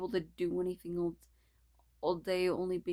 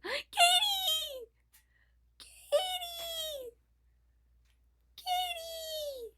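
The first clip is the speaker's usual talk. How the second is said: much higher, squealing through laughter; squealing joy; much slower than usual. Acted. Character calls out to Katie in high squealing delight. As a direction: cry the name out like an overjoyed fan.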